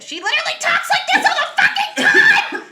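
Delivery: high, manic crackhead impression; crackhead cat voice. Owner demonstrates her other cat, high crackhead voice. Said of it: "My other cat sounds like a crackhead".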